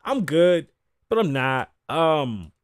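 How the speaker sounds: ordinary talk, nothing marked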